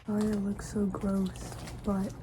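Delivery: ordinary talk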